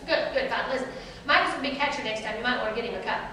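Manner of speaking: rattled off quickly, Northern brisk; mild fast coach impression; fast-talking Michigan coach. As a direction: hurry through it like a busy coach. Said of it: "they talk fast".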